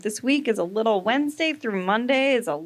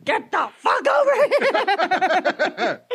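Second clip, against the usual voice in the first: laughing yell, much higher and louder; fed-up owner yelling at a dog; yelled command; 11 semitones higher, 4 dB louder than usual. Retold or imitated. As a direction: bellow it at the dog, half laughing. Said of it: "So you're like".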